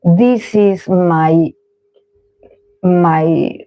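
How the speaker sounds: normal talking voice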